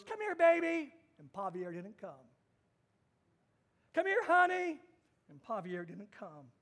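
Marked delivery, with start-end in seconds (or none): 0.0-0.9 higher, louder sweet call to a dog; sweet coaxing. Retold or imitated. Mom coaxing her dog in the field, sweetly. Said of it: "Mom goes". 3.9-4.8 higher, louder sweet call to a dog; sweet coaxing. Retold or imitated. Mom coaxing her dog in the field, sweetly. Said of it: "Mom goes".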